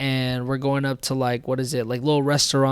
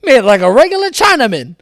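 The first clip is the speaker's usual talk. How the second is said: mock voice; 9 semitones higher, 11 dB louder than usual. Mocking stereotype voice.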